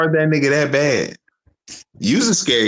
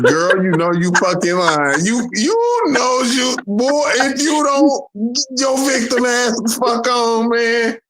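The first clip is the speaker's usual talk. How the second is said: high, slow mock God scolding; voicing God; 10 semitones higher, slower than usual. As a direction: drawl it out as a teasing God. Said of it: "He is like".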